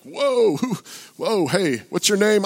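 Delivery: high-pitched retold "whoa"; startled appeasing. Preacher halting an angry heckler to ask his name, startled. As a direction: try to slow an angry man down.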